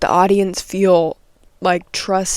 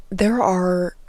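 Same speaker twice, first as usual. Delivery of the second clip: usual speaking voice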